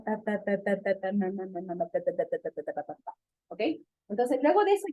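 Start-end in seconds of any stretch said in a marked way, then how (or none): 0.0-3.1 ta ta and na na syllables, growing softer and lower to a near whisper; fading baby babble. Shown as a demonstration. Doctor demonstrating canonical babbling, fading baby syllables. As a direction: let the baby syllables trail away. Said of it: "el niño empieza con el Ta".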